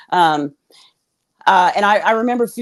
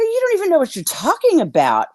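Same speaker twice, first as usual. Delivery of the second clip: indignant denial; 10 semitones higher than usual. Retold or imitated. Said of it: "I go".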